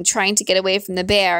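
plain reading voice

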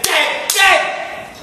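high loud furious dead dead; yelled dead. Acted. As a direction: hammer the word at him. Boss yells at employee.

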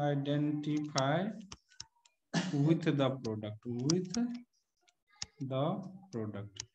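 slow dictation. Acted. Dictates definition slowly to students.

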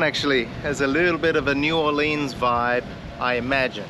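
usual speaking voice

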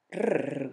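soft grumpy jaguar growl. Acted. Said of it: "gruñó Omar".

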